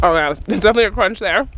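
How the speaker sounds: talking around food; chewing while talking. Real, not acted. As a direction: mouth partly full. Seen 2: Puts dulse in mouth at -1; hand at mouth, chewing while talking.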